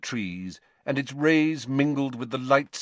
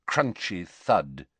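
ordinary delivery

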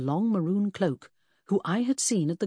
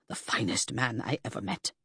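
low, soft grieving tribute; 6 semitones lower, 4 dB quieter than usual. A narrator's reading. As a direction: mourn him quietly. Aide mourns his prince.

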